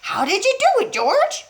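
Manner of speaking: a father thrilled by a miracle; wild excitement. A narrator's reading. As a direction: gush it while dancing around. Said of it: "dancing around and around".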